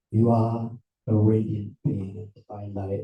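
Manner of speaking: low, level pronouncement; deep-voiced spiritual announcement; solemn proclamation. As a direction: intone it deep and even. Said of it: "I come along and say".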